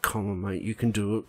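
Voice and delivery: very soft, low urging; barely voiced pep talk, acted out; hushed movie-moment encouragement. Acting out urging a kid to jump, hushed encouragement. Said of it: "eighties movie moment".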